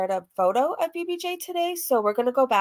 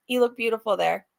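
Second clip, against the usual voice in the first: ordinary talk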